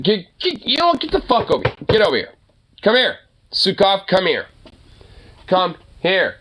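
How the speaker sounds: high, insistent scolding commands; calling the dog. Real, not acted. Owner orders his dog over, insistent calling. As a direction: summon a disobedient pet, impatiently.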